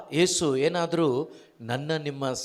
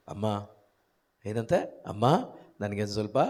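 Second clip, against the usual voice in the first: gentle Jesus; 8 semitones lower, 6 dB quieter than usual. Retold or imitated. Jesus asking the woman at the well, softly and much lower. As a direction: lower and softer, a kind respectful request.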